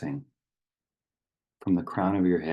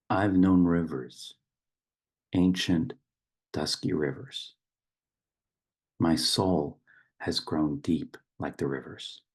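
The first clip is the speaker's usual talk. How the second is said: poem recitation. Acted. Reciting a river poem to open a meditation, solemn poetic delivery.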